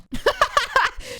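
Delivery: high, rapid giggle; burst of laughter. Real, not acted. Host laughing at a listener's jealous-ex message, high giggle.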